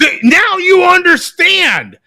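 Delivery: high, loud, drawn-out outburst; loud exclamation. Real, not acted. Co-host relating to the failed accent, loud exclamation.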